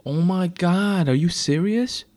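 drawn-out high, loud dismay; upset, near tears. A narrator's reading. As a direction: wail it slowly with throat knotting up. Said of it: "with her throat knotting up".

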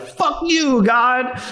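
high, drawn-out retold cry of outrage; railing at heaven. Broken old man cursing God at the sky, railing. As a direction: hurl it up at the sky, acting out despair.

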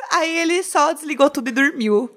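high, giggling end of the story; laughing through it. Real, not acted. Ending the hotel story for friends, laughing throughout. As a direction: finish the line still laughing.

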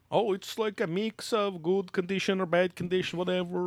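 high-pitched mock Swedish scholar; mock Swedish accent. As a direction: imitate a Swedish academic, pitched well up. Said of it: "the dickhead's like".

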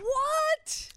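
sky-high shocked cry; shocked what. Real, not acted. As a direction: gasp it out in disbelief. Shocked at cheating text.

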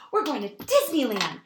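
high, loud, thrilled announcement; imitating a kid bursting with excitement; really excited child. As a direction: burst out with it like a little girl. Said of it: "you seem really excited".

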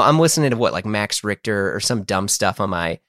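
ordinary delivery

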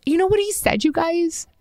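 high, emotional aside; high-pitched and moved; gushing emotion. Real, not acted. Overcome recalling the proposal.

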